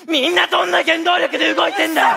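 high, loud demanding cry; exasperated shout. Acted. Tsukishima demanding what drives everyone, high exasperated shout. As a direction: hurl the question out in frustration. Frustrated outburst continues.

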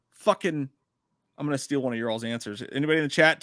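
ordinary talk

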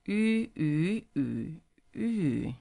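Yu in all four tones in turn. Shown as a demonstration. Teacher runs through all four tones. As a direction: run Yu through flat, rising, dipping, falling. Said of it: "all four tones again".

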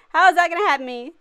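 whiny fake sob; mock crying. Real, not acted. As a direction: wail it like crying. Repeat with crying sound.

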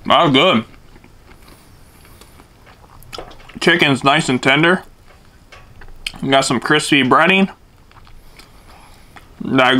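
talking while chewing sandwich; muffled by food in mouth; mouth full, chewing. Real, not acted. Seen 0: Big bite at -3; frames show cheeks working and chewing during the words.

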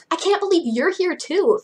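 high-pitched, excited disbelief; exclaiming. Acted. Frankie to Cleo at the party, exclaiming. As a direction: exclaim it with delighted surprise. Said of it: "Frankie exclaimed".

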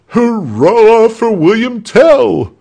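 loud, high, drawn-out cheer; roaring crowd. A narrator's reading. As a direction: roar a hurrah with the crowd. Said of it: "roared the crowd".

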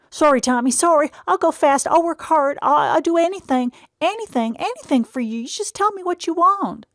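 anxious to please, pleading; eager simple brother. A narrator's reading. Eddie apologising eagerly to older brother Tom.

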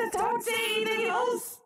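high, distorted squawk; distorted high-pitched creature; filtered Toad voice. Pretend. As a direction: screech it through a voice filter.

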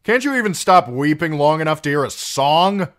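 high, loud exasperated snap; snapping. A narrator's reading. Singer snapping at Gilly for weeping, exasperated. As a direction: rail at her in disgust. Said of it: "Darion snapped".

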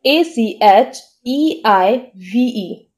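spelling out the wrong version slowly; slow letter-by-letter spelling. Shown as a demonstration. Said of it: "जैसे कि".

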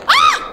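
sudden very high shriek; jump-scare scream. Real, not acted.

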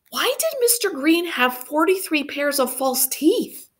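put-on high puzzled child's question; curious child. A narrator's reading. Girl asking the storyteller, high curious child voice. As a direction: ask it like a wide-eyed kid.

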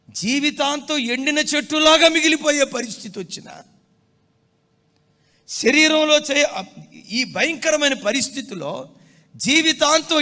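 loud, high pulpit proclamation; fervent sermon voice; full-force preaching. Acted. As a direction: preach it out to the congregation.

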